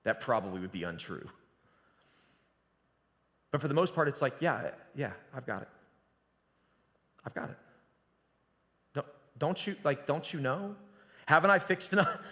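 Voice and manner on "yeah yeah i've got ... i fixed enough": half-hearted, weary reassurance; dad to daughter Brooklyn, mildly annoyed. Retold or imitated. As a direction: brush it off, a little put out. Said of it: "I never get annoyed".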